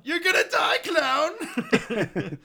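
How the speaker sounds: very high, loud mock rage breaking into laughter; angry yell, laughing. Quoting Happy threatening the clown, yelling through laughter. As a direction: yell the threat while cracking up. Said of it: "another iconic, uh, line".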